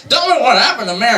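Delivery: high, urgent, desperate demand; frantic pleading. Retold or imitated. George begs the angel for news of Mary, high and frantic. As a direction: beg the angel to tell you.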